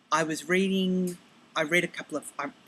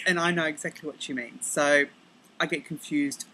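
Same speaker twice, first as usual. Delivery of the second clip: usual speaking voice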